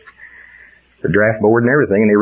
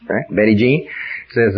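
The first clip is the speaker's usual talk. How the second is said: ordinary talk, nothing marked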